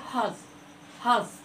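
"हॉर्स" with a breathy h push; aspirated h. Shown as a demonstration. Teacher showing kids the energy of the h sound. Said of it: "हॉर्स बोल करके देखिए".

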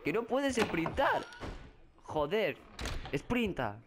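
high, slow exasperated yell; frustrated cry. Real, not acted. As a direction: vent at the game, drawn out. Frustrado porque no puede correr. (grito exasperado, agudo y lento)

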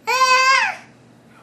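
high, slow, drawn-out squeal; excited squeal. Real, not acted. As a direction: stretch it into a thrilled squeal.

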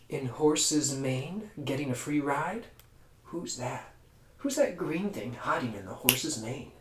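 put-on storyteller hushing the children; hushed mystery. A narrator's reading. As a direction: lean in and whisper the suspense.

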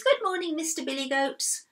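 high, cheery greeting; happy sing-out hello. Acted. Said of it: "in a very happy voice".

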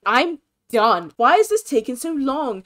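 loud acted fed-up complaint; snappish. Sim in long labor snapping at everyone, exasperated. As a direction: play a fed-up mother-to-be snapping. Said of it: "She can't help but snap at everything".

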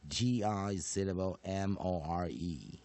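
measured, emphatic classroom chanting of each rule; phonics drill recitation. Shown as a demonstration. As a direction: recite it step by step, pausing between parts.

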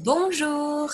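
bright model Bonjour for the class; teacher answering Bonjour for the pupils; French bonjour, high. Shown as a demonstration. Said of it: "Which one's missing".

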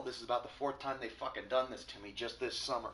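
loud, fed-up complaint; real anger. Real, not acted. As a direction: spit out the frustration. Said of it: "I'm a little pissed, can you tell?".

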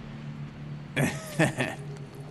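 soft, breathy mocking laugh; low villain chuckle. Eddie laughs off Drake's insult, low chuckle. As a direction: chuckle at his old rival.

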